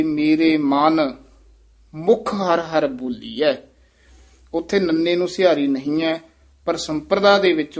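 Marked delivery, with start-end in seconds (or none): 0.0-3.5 very slow, with a pause between ਮਨ and ਮੁਖ; careful slow recitation; ਮਨ apart from ਮੁਖ. Shown as a demonstration. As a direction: separate ਮਨ and ਮੁਖ. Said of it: "ਅਰਥ ਨੂੰ ਸਪਸ਼ਟ ਕਰਨ ਵਾਸਤੇ".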